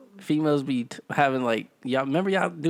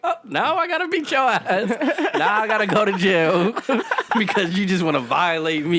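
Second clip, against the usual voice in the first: high, loud comic protest breaking into laughter; mock outrage; 5 semitones higher, 4 dB louder than usual. Comic reply to a woman's insult, laughing mock outrage.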